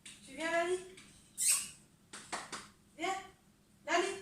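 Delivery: appel haut et chantant vers la chatte. Real, not acted. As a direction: appelle ton chat dans le jardin, voix haute. (appel aigu et chantant à sa chatte Lali)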